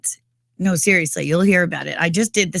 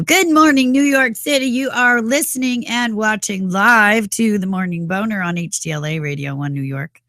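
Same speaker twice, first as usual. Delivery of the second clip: big morning show host welcome; radio announcer; 3 semitones higher than usual. Acted.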